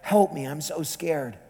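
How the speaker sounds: frightened woman praying quietly in a cell; silent prayer. Retold or imitated. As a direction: pray it under the breath. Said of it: "She prays silently".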